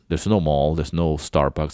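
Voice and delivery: ordinary talk, nothing marked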